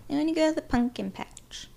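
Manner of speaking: high-pitched mimicry, "pumpkin" as "punkin"; mocked man. Mimicking a man who says it wrong, high mocking voice. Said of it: "if he says".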